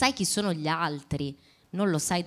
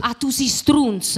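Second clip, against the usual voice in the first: slow, loud, higher Neapolitan insult; Neapolitan accent; 9 semitones higher, 10 dB louder, slower than usual. Shown as a demonstration. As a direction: say it like a Naples local. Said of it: "anche il napoletano l'hai fatto bene".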